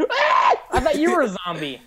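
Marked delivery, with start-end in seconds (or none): 0.1-0.6 sudden high startled shriek; startled scream. Real, not acted. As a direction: shriek in alarm. Surprised by mobs.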